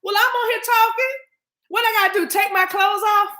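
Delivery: high, loud exasperated outburst. Retold or imitated. Venting at chat about spa-style messages, loud and exasperated. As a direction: throw up your hands at the chat. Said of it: "And I'm like".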